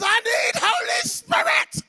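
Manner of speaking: hammed-up toddler shriek, slow and loud; imitated child's yelling. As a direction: mimic a little girl hollering in distress. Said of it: "yelling it louder and louder".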